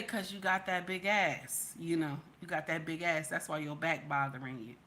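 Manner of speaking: flirting man. Retold or imitated. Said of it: "one of those men say".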